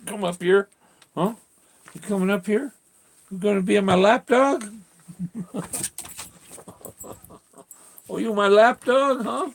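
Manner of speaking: high, lilting pet voice. Real, not acted. Owner greets dog Jack coming back, high baby talk. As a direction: fuss over the dog up high. Coaxing dog onto lap.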